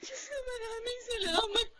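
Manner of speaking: whispered, weeping thanks to God; a mother thanking God her child is safe; tearful relief. Acted. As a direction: breathe out a broken prayer of relief. Mother's relieved prayer in chapel.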